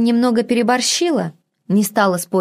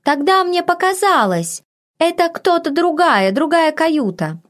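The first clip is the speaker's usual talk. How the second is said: put-on high, flustered broken accent; embarrassed maid in broken northern speech, much higher; embarrassed maid in dialect; 9 semitones higher than usual. A narrator's reading. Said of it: "смутилась горничная".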